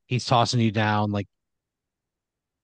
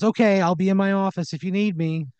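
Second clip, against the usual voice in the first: high, airy sign-off from the boss; higher character voice, breezy boss; 9 semitones higher, 3 dB louder than usual. Acted. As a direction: toss it off in a pitched-up owner voice. Owner signs off to worker.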